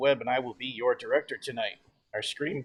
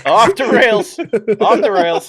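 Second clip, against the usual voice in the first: loud laughing cry, the second drawn out slowly; laughing shout; 8 semitones higher, 14 dB louder, much slower than usual. Real, not acted. Director laughing as players riff on place names, loud slow laughing shout. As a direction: yell it through laughter as things derail. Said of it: "director laughing at chaos".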